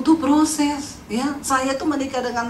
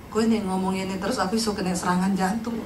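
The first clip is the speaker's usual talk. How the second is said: husband's complaint; 8 semitones lower, 7 dB quieter than usual. Retold or imitated.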